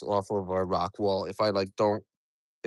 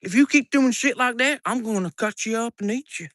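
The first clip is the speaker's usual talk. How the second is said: higher, louder hammed-up threat; mock threat to a flower; 10 semitones higher, 3 dB louder than usual. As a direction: play a silly tough guy threatening a flower. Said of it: "go up to that flower and say".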